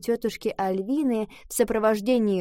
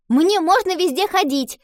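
loud, high defiant shout; 9 semitones higher, 6 dB louder than usual. A narrator's reading. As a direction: yell it out defiantly. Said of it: "крикнула Гретхен".

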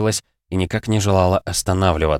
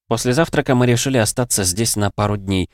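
ordinary delivery

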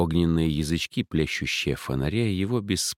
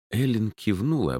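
ordinary delivery

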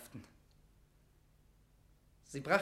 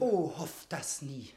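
grave warning; 3 dB louder, slower than usual. Acted. Mortimer dismisses hope in Elizabeth.

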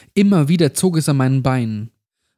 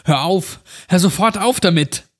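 shout; 5 semitones higher than usual. A narrator's reading. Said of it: "Ich schrie das kleine Mädchen an".

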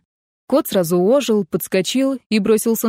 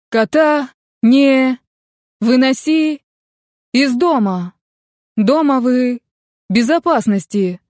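halting, drawn-out words, broken by gaps; words pushed out piece by piece over a bad connection; voice through interference; 4 semitones higher, 4 dB louder, slower than usual. A narrator's reading. Friend warns her over a failing phone line, broken up and halting. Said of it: "голос теперь доносился словно издалека".

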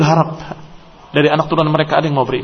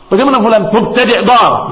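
mock verdict; 6 semitones higher, 5 dB louder than usual.

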